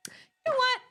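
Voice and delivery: high, defiant mock-outraged retort; indignant comeback. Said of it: "And I was like".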